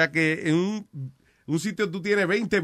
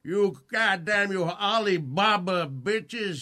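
put-on Southern accent; 3 semitones higher, much slower than usual. Mocking racist rant.

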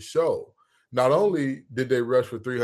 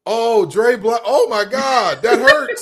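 high, loud anguished wail; loud, dismayed yelp climbing higher; pained outcry; 9 semitones higher, 8 dB louder, slower than usual. Real, not acted. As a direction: cry out as if the name wounds you. Pained reaction to coach's name.

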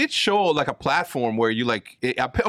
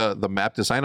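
ordinary talk

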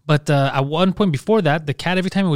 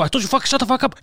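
higher, exasperated mock yell; 8 semitones higher than usual. At four in the morning, owner yells at hissing cat, exasperated. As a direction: snap at the cat like you've had enough.

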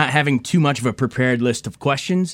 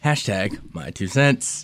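slow, drawn-out hashtag announcement; slow announcer tag; 4 semitones lower, much slower than usual. Pretend. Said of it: "Are you doing my hashtags?".

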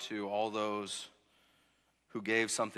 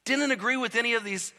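higher, louder preaching climb; full-force preaching; 9 semitones higher, 9 dB louder than usual. Acted. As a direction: preach it out with rising fire. Preacher building to a peak.

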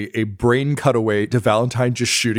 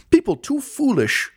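pompous, higher, self-important lecturing; puffed-up clerk; 8 semitones higher than usual. Acted. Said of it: "puffs himself up a little bit".

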